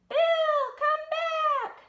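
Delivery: high loud call after him. Acted. Brother calls after Bill Bunny, high and loud. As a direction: shout it after the running bunny.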